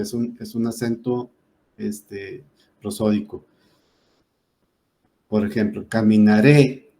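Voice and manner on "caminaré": caminaré said slow and loud, landing on RÉ; stress on RÉ. Shown as a demonstration. Teacher demonstrating an aguda ending in E, stress on RÉ. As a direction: draw out caminaré and punch the final RÉ. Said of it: "la fuerza de la voz recae en la última sílaba".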